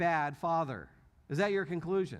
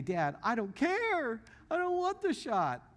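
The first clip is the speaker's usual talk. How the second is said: hammed-up little-girl whine, much higher; imitating stubborn daughter; 9 semitones higher than usual. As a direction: drag it out like a stubborn five-year-old. Retold daughter refusing shot again.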